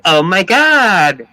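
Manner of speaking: put-on loud, drawn-out Valley girl accent; mock a gushing Valley chick; stretched Valley-speak exclamation. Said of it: "Valley chicks".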